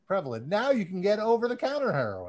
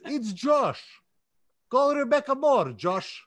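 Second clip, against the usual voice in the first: loud, higher hammed-up Russian accent; 4 semitones higher, 4 dB louder than usual. Reading out fan shoutouts on the podcast in a hammed-up Russian accent. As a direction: ham up a thick Russian accent. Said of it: "I'm gonna do it with a Russian accent for you".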